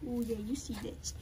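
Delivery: ordinary talk, nothing marked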